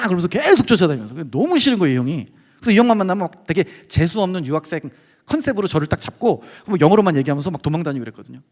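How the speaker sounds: ordinary delivery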